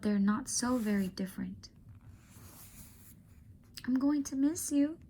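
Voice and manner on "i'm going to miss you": put-on much higher girlish voice, tender and sad; a girl saying goodbye to her friend; young girl Julia.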